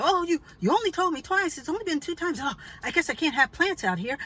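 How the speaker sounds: high, flustered mock protest; neighbor in a tizzy. Neighbor protesting the complaint, high mock tizzy. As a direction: mimic her getting worked up, pitched high. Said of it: "she got into a tizzy".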